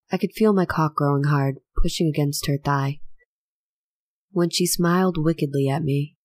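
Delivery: usual speaking voice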